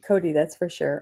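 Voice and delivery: ordinary delivery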